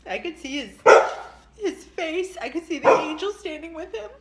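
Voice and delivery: high, teary, loud bursts; crying. Real, not acted. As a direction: still crying through the words. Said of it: "It's a good cry".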